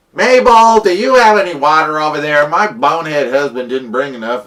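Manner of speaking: loud, high call across the camp; yelling mom. Acted. Mom calling across camp to Mabel, loud and exasperated. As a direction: holler to the neighbors, fed up. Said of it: "She yells at the group".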